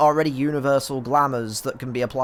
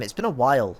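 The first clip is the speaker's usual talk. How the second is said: ordinary talk, nothing marked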